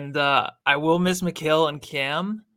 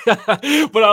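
laughing; 7 semitones higher, 10 dB louder than usual. Real, not acted.

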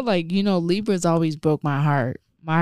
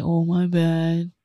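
imitating him; slower than usual. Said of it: "He's like".

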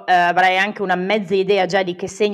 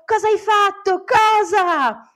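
high-pitched imitation of furious yelling; high, outraged mock yell; retold yelling; 12 semitones higher, 3 dB louder than usual. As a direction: imitate someone screaming accusations, pitched way up. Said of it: "Mi urlava contro".

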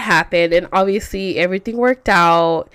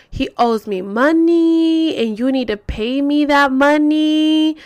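slow, higher, held-out demand; mock letter-reading; 5 semitones higher, slower than usual. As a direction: stretch the demand in a raised recital.

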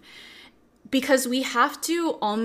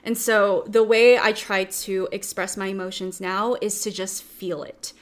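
normal talking voice

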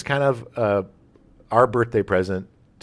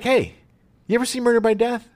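eager mock question; 11 semitones higher, faster than usual. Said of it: "be like".

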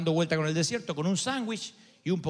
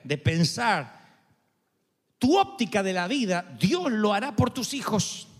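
fiery preaching; 4 semitones higher than usual. Acted. Preaching at full force.

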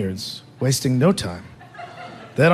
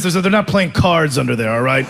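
normal talking voice

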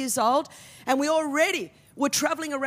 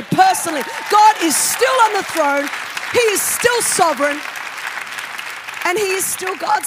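loud, building proclamation; preaching shout; 7 dB louder than usual. Real, not acted. To the congregation, proclaiming that God is still in control, at a loud shout.

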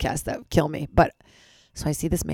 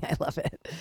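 whisper; 7 dB quieter than usual. Real, not acted. Whispered aside.

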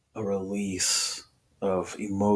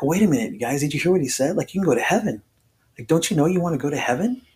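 retold protest, pitched up; earnest pleading; 7 semitones higher, 3 dB louder, faster than usual. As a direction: plead with doubting buddies in a higher voice. Acted earnest plea to doubting buddies.

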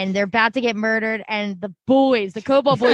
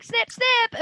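much higher, sing-song "Snip, snip"; mock careless snipping; 11 semitones higher than usual. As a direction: chirp it like a cheerful butcher. Mocking a careless surgeon.

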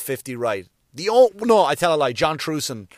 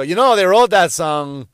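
higher, much louder burst of trivia; eager know-it-all; 5 semitones higher, 9 dB louder than usual. Retold or imitated. Telling Vic about a song, eager and loud. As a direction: jump in loudly, showing off what you know.

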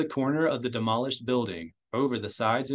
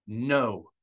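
plain reading voice

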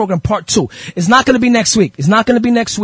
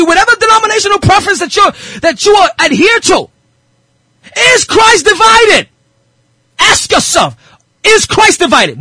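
impassioned preaching; 10 semitones higher than usual. Real, not acted. Preacher challenging the holy hip hop community, impassioned. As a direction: press the congregation with a ringing challenge.

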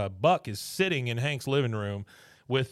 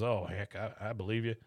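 softer retelling; 3 semitones lower, 7 dB quieter than usual. Retold or imitated. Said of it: "this is what I was talking about".

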